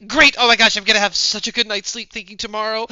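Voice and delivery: high, loud burst of relief; excited. Acted. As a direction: gush with loud delight. Character celebrates after "she's like.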